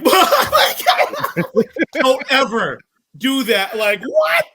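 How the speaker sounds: loud, high-pitched disbelief; shrieked, indignant amazement; shocked outburst. Retold or imitated. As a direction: re-enact exploding at a prank. Reaction to the fake-out good news.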